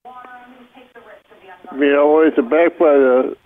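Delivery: ordinary talk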